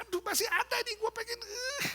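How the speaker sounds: very high, strained outburst; mock frustrated yelling. Angry person still unrelieved after screaming, mock frustrated wail. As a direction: cry out as if anger still remains, hammed-up. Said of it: "Teriak-teriak juga rasanya".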